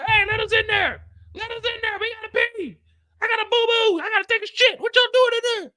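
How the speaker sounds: high whining pleas; whiny high voice. Retold or imitated. People outside begging to get in, high whine. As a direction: whine high like a desperate crowd.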